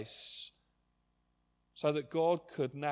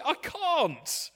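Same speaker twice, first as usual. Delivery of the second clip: much higher, pleading I can't; helpless admission; 12 semitones higher, 5 dB louder than usual. Pretend. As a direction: throw up your hands and confess it. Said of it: "you'll say".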